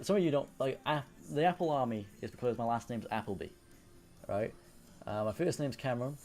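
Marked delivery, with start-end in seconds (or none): none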